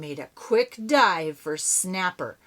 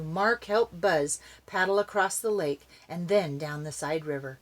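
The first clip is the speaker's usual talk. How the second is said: usual speaking voice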